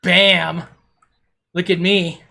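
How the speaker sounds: loud, cocky mock show-off voice; boastful Kithid. Streamer voicing the builder's triumphant return, loud and cocky. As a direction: strut back in bragging. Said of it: "Kithid's like".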